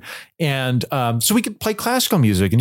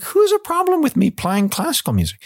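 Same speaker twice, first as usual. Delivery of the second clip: indignant outburst. Retold or imitated. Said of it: "And I'd be like".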